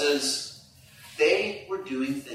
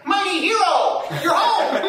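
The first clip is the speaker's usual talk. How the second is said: much higher, louder cheer of "Mighty hero"; a child's thrilled welcome at the door; shouted, beaming "Mighty hero"; excited greeting; 14 semitones higher, 6 dB louder than usual. Pretend. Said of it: "Owen say".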